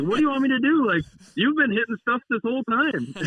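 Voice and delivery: high, loud mock protest; indignant comeback. Teammate answering the scolding, indignant high-pitched retort. Teammate yells back in retold argument.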